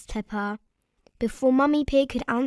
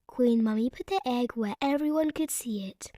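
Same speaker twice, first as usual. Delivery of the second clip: ordinary delivery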